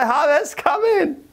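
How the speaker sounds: high sing-song call; farmer calling again. Retold or imitated. Farmer invites the harvest into the cozy barn, high coaxing call. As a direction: coax the harvest in with a lilting call. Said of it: "look at the harvest and say".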